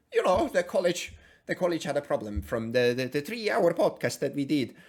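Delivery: mock Swedish-accented impression; halting, hammed-up Swedish accent; Jordan Peterson, Swedish accent. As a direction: put on a silly Swedish accent. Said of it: "like, a Swedish accent for him".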